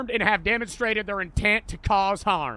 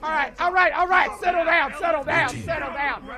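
police pounding door; 5 semitones higher than usual. Pretend. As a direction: bark it like a SWAT cop. Cop roleplay shouting at suspects.

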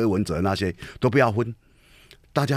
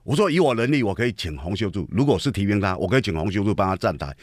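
ordinary talk

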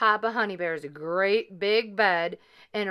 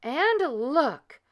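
higher, lightly voiced mama bear cry; a hint of mama bear, pitched up; middle-sized bear voice; 6 semitones higher, 3 dB louder than usual. A narrator's reading. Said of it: "in her middle-sized bear voice".

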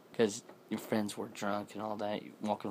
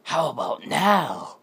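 higher, much louder, slow roar; slow shrieking monster yell; monster shout; 7 semitones higher, 11 dB louder than usual. Acted. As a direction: bellow it to terrify. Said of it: "and he shouts at it".

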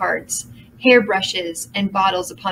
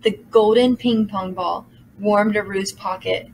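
ordinary delivery

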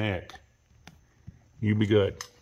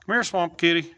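high, loud pet call; calling a cat; 7 semitones higher, 5 dB louder than usual. Real, not acted. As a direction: call the cat over, pitched up and louder.

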